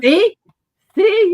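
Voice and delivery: high, loud teasing; gleeful taunt. Retold or imitated. Wife taunting husband over his snoring, high and loud. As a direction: rub it in, gleefully.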